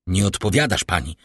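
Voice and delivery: ordinary delivery, nothing marked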